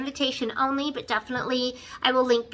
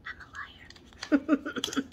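playful whisper; 2 semitones higher, 8 dB quieter, much slower than usual. Real, not acted. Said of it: "I lied".

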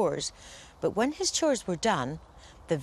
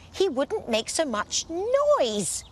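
grumpy fairy thought; 9 semitones higher, slower than usual. Acted. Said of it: "she thought".

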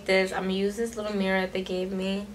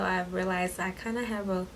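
ordinary talk, nothing marked

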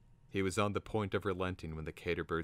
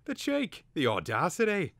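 high, loud bird outrage; indignant bird exclaiming; 12 semitones higher, 8 dB louder than usual. A narrator's reading. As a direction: squawk it in affront. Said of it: "the cater bird exclaimed".

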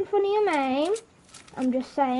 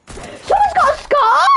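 high, loud yell; excited shout; 11 semitones higher, 7 dB louder than usual. Real, not acted. Gamer spotting someone's scar, excited shout. Spots a weapon in game.

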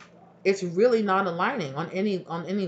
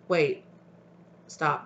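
normal talking voice

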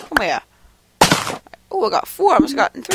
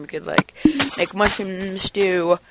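ordinary delivery

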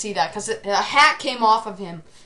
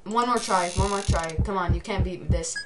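ordinary delivery

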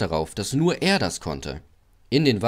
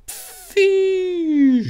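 long, loud hissing burst; steam burst noise; 7 dB louder than usual. A narrator's reading. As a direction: hiss like escaping steam. Ventil platzt, Dampf zischt. (lautes, langes Dampfzischen)

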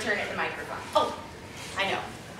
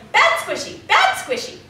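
high, loud mock scolding; Poseidon scolding; 13 semitones higher, 4 dB louder than usual. Poseidon stung by a jellyfish, high loud scolding. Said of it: "and he goes".

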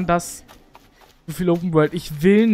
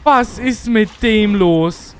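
loud bewildered; 3 semitones higher, 6 dB louder, much slower than usual. Real, not acted. Confused by game enemy.

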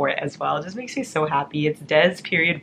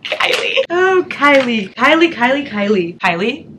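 gleeful calling; 7 semitones higher, 7 dB louder than usual. Real, not acted. Calling fan's name, overjoyed.

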